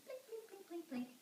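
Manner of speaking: high, soft plink-plink; tiny plucked-string noises in a high voice; imitated ukulele plinking. Storyteller imitates the boy's ukulele, high plinking. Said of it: "he would play his ukulele".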